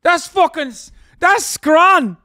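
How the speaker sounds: high, shouted northern English impression; mock northern English. Streamer cheers the kebab, mock northern English shout. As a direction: yell it in a hammed-up British accent. Mock British reaction to the clip.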